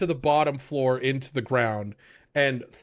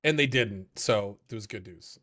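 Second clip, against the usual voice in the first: normal talking voice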